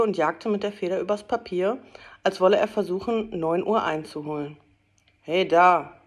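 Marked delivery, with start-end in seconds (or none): 5.2-6.0 louder, a hint of a grumbling old miser; mock gruff growl. A narrator's reading. Scrooge faking his usual gruffness to the late clerk, mock growl. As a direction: put on his old grumpy voice. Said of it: "brummte Scrooge ... seine gewohnte Stimme nachmachend".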